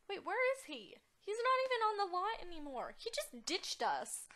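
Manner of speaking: high, loud, scandalized disbelief; indignant outburst. Pretend. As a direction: exclaim it, outraged at being ditched. Date vanished in the game.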